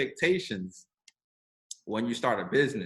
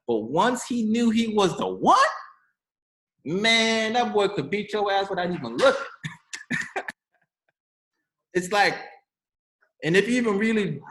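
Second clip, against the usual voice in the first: excited; 8 semitones higher than usual. Real, not acted. Hyped Matrix retelling.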